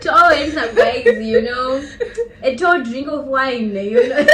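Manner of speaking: laughing, teasing tone. Real, not acted. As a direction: giggle through it. Laughing about handsome guys.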